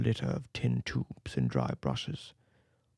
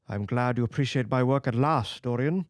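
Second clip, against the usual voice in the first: ordinary delivery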